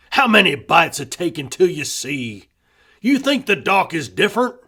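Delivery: loud, bitter spitting of the words; bitter rant. A narrator's reading. Husband ranting to wife about townies, loud and bitter. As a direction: warn her the townies are snakes.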